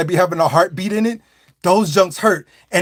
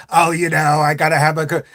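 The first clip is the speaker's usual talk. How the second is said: raspy smoker lady; 4 semitones lower than usual. Retold or imitated. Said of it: "the woman with the raspy voice".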